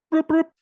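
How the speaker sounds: two high, loud rolled-R trills; playful R trill. Shown as a demonstration. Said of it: "roll your R's".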